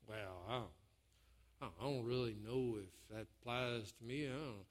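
low, soft, hesitant mumble; doubtful thought. Acted. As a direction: think it aloud, low and unsure. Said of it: "you think".

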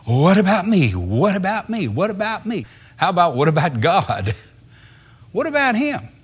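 high, whiny mock of me-first worry; self-centred disciples. Preacher mimicking the self-absorbed disciples, high and whining. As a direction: mimic their self-pity. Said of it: "the only thing they could think of was".

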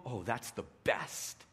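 slow, dripping sarcasm; mock praise with a sneer; sarcastic. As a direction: church man mocking, slow and sarcastic. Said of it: "with every ounce of sarcasm".

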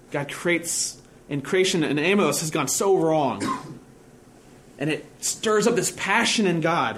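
ordinary talk

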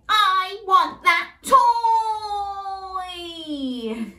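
high, loud, slow whine to copy; whiny demand. Shown as a demonstration. Host shows children the whiny demand, high and slow. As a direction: model the whine for kids. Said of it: "Can you say".

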